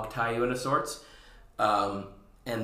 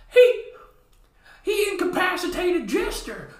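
much higher, louder panicked shout; panicked clown; 6 dB louder than usual. Acted. Rodeo clown shouting that the hero beat Jester, high panicked squeal. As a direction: squeal it like a frantic cartoon clown. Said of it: "rodeo clowns go".

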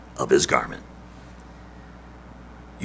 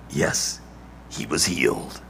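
soft affirmation; 9 semitones lower, 11 dB quieter than usual. Acted. Quiet affirmation after story.

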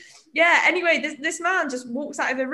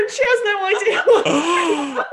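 ordinary talk

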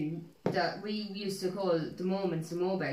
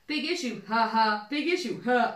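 pitched-up taunt, punched ha; door-knocking taunt; 3 semitones higher than usual. Retold or imitated. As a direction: imitate a childish doorstep prank call. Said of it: "people's doors and go".

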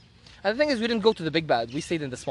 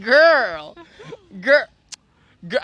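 mock exasperated "girl", louder then cut short; exasperated call to someone who won't wake; 4 semitones higher, 4 dB louder, much slower than usual. Retelling her exasperation at sleeper.

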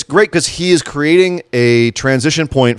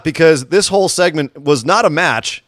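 normal talking voice

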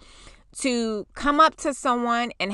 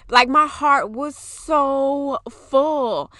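slow, higher and tender; moved; 6 semitones higher, much slower than usual. Real, not acted. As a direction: savor how full your heart is. Overwhelmed with feeling.

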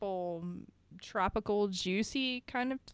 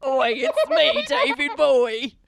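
higher, louder laughing Australian shout; mock Australian; 5 semitones higher, 13 dB louder than usual. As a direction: yell it in a hammed-up Aussie accent. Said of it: "He's Australian".

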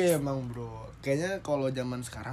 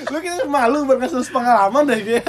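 laughing; 7 semitones higher, 11 dB louder than usual. Real, not acted. Teasing friend about experience.

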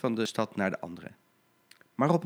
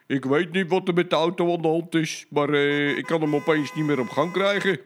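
raised, louder voice of a red-faced driver; flustered truck driver; 5 semitones higher, 4 dB louder than usual. Acted. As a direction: explain helplessly, voice up. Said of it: "hij riep".

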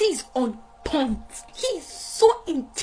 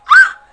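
high, loud "ah!"; sudden high-pitched exclamation, very loud; overcome cry out; 14 dB louder than usual. Real, not acted. Overcome while preaching on rescue.

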